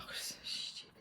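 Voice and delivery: a breathy scoff kept under the breath; scornful inner whisper. Retold or imitated. Mocking her own inner voice in a hush. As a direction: dismiss it in a hushed sneer. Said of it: "I thought".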